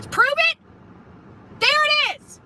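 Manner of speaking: high, taunting "prove it"; triumphant mock. Real, not acted. Mocking the man's "prove it.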